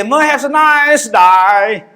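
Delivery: put-on loud, very slow "Have a nice day" in a drawled Aussie accent; mock Aussie accent. Mocking the officer's farewell in a loud drawled Aussie accent. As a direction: stretch every word like an Aussie. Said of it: "Bahasa dia".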